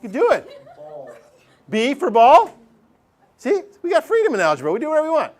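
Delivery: high, laughing, playful cheer; playful excitement. Real, not acted. Teacher playfully tells students to pick any letter, high and excited. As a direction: sell algebra freedom like a game. Playful freedom-in-algebra riff.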